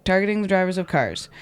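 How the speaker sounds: normal talking voice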